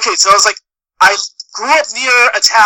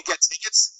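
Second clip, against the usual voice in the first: ordinary talk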